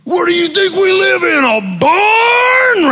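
high, loud, hammed-up father's bellow; imitated dad shouting at the kids; yelling dad. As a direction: bellow it in exasperation, stretching the last word. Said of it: "I can remember him yelling that".

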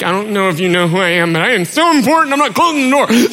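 fast, high, puffed-up rant; hammed-up pompous refusal, rising in pitch; mock self-important. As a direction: rattle it off in comic indignation. Mocks own self-important refusal.

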